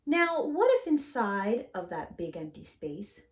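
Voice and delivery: put-on gentle read-aloud to children; storybook reading. A narrator's reading. Teacher reading a picture book to kids, gentle and lively. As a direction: read it warmly, like story time.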